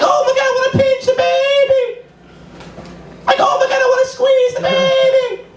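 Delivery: sky-high squealing falsetto; baby-talk voice. Acted. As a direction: squeal with adoration, stretching it out. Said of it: "that's the regular baby voice".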